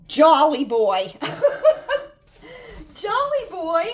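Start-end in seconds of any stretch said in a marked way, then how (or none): 1.2-2.1 high burst of real laughter; surprised laugh. Real, not acted. Owner seeing the kitten upstairs, a high delighted laugh.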